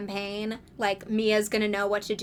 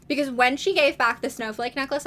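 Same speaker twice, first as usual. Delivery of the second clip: ordinary delivery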